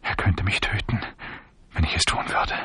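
hushed, fearful whisper; soft, secretive undertone; hunted whisper. A narrator's reading. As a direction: whisper it as if overheard. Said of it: "sah sich gehetzt um und flüsterte".

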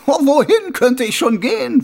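high, loud mocking voice; a smug killer playing innocent; sneering villain. A narrator's reading. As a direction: Carcer mocking the watchman. Karzer mocks.

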